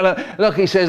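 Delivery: usual speaking voice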